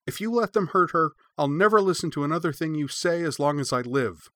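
put-on girl glaring and threatening the masters; furious threat. A narrator's reading. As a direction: hurl it at them. Cora glaring, threatening elders.

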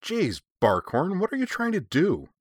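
high-pitched exasperated reproach; incredulous scolding, pitched well up; demanding. A narrator's reading. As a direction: demand an explanation, appalled. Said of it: "Shirley demanded".

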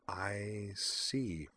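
quiet, awkward I see; uncomfortable. Acted. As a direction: swallow the words, ill at ease. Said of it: "said Marie uncomfortably".

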